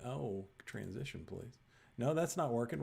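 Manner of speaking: normal talking voice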